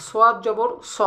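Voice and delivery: স as a heavy, rounded "so"; thick s. Shown as a demonstration. Teacher comparing the letters, thick s for sad. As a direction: end on স with a thickened s and round vowel. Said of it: "পার্থক্যটা কোথায়".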